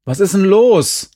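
loud, high question; exasperated shout from outside; calling out. A narrator's reading. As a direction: call the question through a closed door. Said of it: "rief Uwe jetzt von außen".

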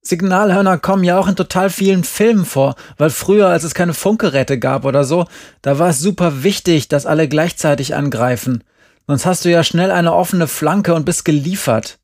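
high-pitched, animated self-justification; heated protest. A narrator's reading. Jerry recounts explaining the painting to the teacher, heated. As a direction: argue your case with rising exasperation.

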